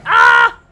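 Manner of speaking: sudden piercing high shriek; loud frightened scream; startled scream. Real, not acted. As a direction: yelp in real fright. Sudden game scare.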